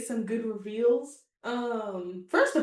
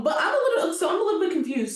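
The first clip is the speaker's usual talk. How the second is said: high, giggly restart; laughing while talking; 8 semitones higher, 4 dB louder, faster than usual. Real, not acted. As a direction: try to get back on topic through laughter. Still laughing as she resumes.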